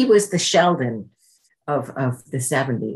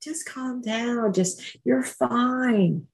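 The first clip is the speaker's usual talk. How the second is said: inner tempting voice; 9 semitones higher, much slower than usual. Retold or imitated. The inner voice talking her out of AA, high slow soothing. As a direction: lull her gently off her plan. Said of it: "This is what was going on in my head".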